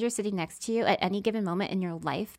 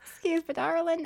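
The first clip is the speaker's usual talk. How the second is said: put-on a lightly done Southern accent; 9 semitones higher than usual. Seatmate sweetly calling her darling, mild Southern accent. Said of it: "a Southern accent".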